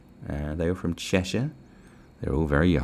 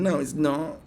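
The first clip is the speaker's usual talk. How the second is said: normal talking voice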